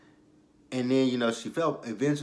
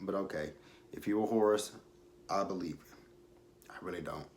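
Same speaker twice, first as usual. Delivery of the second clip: normal talking voice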